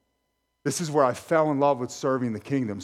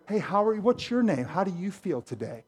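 lightly voiced, higher-pitched greeter; mock friendly stranger; 6 semitones higher than usual. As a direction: imitate an over-eager stranger making small talk. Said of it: "come up to you and".

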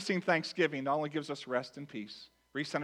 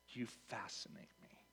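hushed, barely voiced murmur, as imitation; imitating wife's whisper; 5 semitones lower, 18 dB quieter than usual. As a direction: whisper it as the wife would. Wife's remark acted out.

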